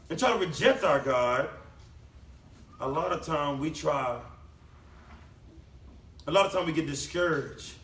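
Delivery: ordinary talk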